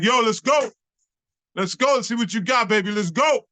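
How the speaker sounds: high, punchy callout; acted-out hyped-up rival; goading challenge. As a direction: call him out to battle. Rival calling him out to battle.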